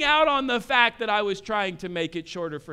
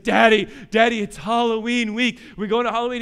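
put-on excited child; 2 semitones higher, 6 dB louder, faster than usual. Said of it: "He's all fired up".

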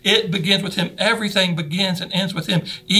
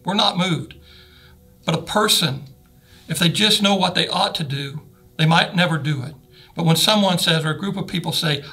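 ordinary talk

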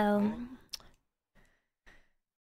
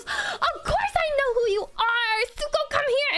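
much higher, slow, delighted greeting; excited welcome; 13 semitones higher, slower than usual. Retold or imitated. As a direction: welcome your friend with open arms. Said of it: "And she goes".

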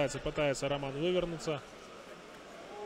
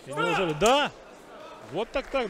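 excited; 4 semitones higher, 6 dB louder than usual. Real, not acted. Submission finish in commentary.

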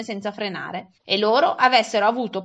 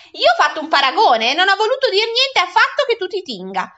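high, spiteful self-defence; nettled woman firing back; increasingly vexed; 8 semitones higher, 4 dB louder than usual. A narrator's reading. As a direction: retort with mounting irritation, pitched up. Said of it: "sempre più indispettita".

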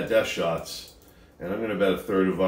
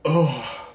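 pained groan; 6 semitones higher, 5 dB louder than usual. Real, not acted. Throat burning.

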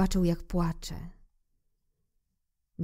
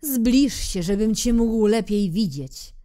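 proud king; 4 semitones higher, 5 dB louder than usual. Acted. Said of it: "powiedział król".

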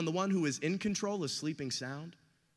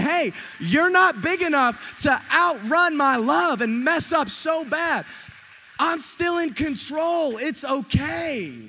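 loud, high, slow preacher's shout; booming, drawn-out declaration as God; emphatic reassurance; 7 semitones higher, 10 dB louder, slower than usual. Acted. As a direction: proclaim it slowly at full force. Voicing God's emphatic reassurance to congregation.

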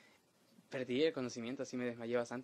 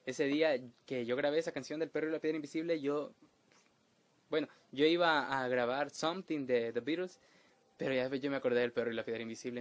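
usual speaking voice

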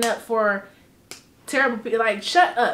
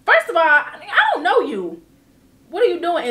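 indignant confrontation; 6 semitones higher, 5 dB louder than usual. Retold or imitated. Retelling confronting a stranger.

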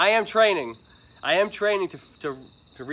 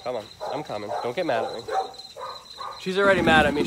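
ordinary talk

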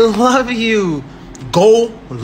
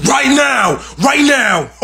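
shout; 5 semitones higher, 6 dB louder than usual. Pretend. Demanding follows.

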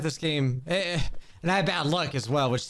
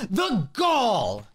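mock outrage; 3 semitones higher, much slower than usual. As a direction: cry out in hammed-up disbelief.

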